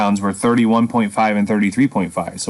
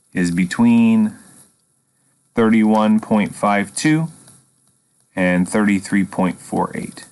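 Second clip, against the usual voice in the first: slow dictation; slower than usual. Shown as a demonstration. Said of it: "that's how we finish it".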